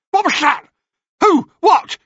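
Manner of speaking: high, loud alarmed crone; startled hag. Acted. Blinded hag startled by Perseus, high alarmed cry. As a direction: jump like a spooked witch.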